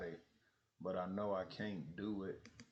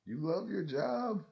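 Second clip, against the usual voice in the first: higher, slow, doubting; skeptical question; 9 semitones higher, 5 dB louder than usual. Retold or imitated. To a coworker, slow skeptical question. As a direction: question it in disbelief. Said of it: "And I was like".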